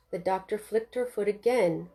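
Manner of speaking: ordinary delivery, nothing marked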